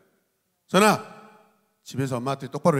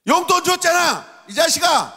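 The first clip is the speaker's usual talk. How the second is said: much higher, loud exasperated yell; scolding parent; 12 semitones higher, 4 dB louder than usual. Acted. Parent sends thirsty child to the mart, angry yell. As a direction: scold the kid at full pitch.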